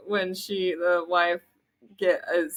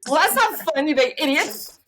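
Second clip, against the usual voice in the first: high, loud playful scolding; mock-snap at him; wife scolding husband who apologized; mock annoyed; 7 semitones higher, 5 dB louder than usual. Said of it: "I'm like".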